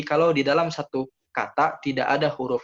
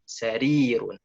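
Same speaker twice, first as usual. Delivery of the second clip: sa-RIII-run, long middle vowel; stress on long ya; 2 semitones lower, slower than usual. Shown as a demonstration. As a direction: hold the "ri" in Sarirun. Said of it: "maka tekan".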